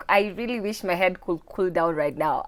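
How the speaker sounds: speaking through a migraine, subdued and low-energy; low, worn-out voice of someone with a bad headache. Real, not acted. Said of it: "a severe migraine".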